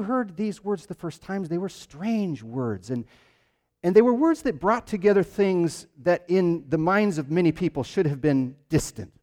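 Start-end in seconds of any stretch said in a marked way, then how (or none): none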